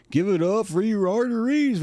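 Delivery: high-pitched emcee rousing applause; playful announcer cry; mock hype man. Mock crowd-rousing call to applaud.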